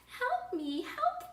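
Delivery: high squeaky cry for help; bunny crying for help. Acted. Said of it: "the bunny cried".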